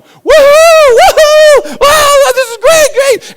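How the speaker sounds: very high, loud whoops of joy; gleeful kid shouting. Retold or imitated. Kid in the manure pile, shrieking with joy. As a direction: shriek with delight. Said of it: "they hear it louder and louder".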